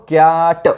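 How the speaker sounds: कॅट with a very long drawn vowel; phonics blending. Shown as a demonstration. Teacher showing how to read it, कॅट slowly blended. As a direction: stretch कॅट out, then release ट. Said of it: "काय करतो आपण?".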